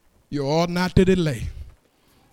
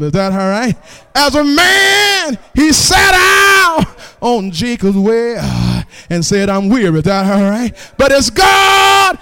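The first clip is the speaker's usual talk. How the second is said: rising shouted whoop on each "But as God", dropping back between; full-force preaching; 3 semitones higher, 8 dB louder, slower than usual. Acted. Preacher whooping to the congregation about Jesus the winner. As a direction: preach it like a call and response, climbing to a roar.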